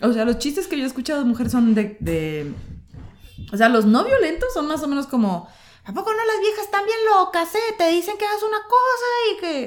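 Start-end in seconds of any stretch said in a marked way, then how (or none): 5.8-9.6 put-on northern Mexican accent. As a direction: impersonate a macho middle-aged northern dad. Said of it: "estoy hablando como mi papá".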